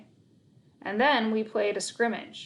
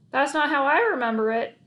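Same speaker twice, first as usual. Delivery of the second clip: grumbling; 5 semitones higher, 4 dB louder than usual. A narrator's reading. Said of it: "I grumbled".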